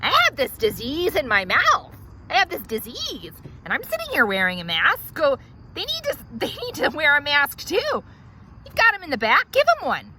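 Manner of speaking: rising high, loud outrage; entitled patient escalating to a high shout; indignant demand. Acted. Demanding others wear masks.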